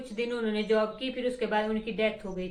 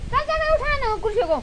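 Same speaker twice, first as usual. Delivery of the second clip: high, raised urging call; calling out orders; 12 semitones higher, 5 dB louder than usual. Real, not acted. Ordering someone to lift the chairs, high urging call. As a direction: call out a hurried chore order.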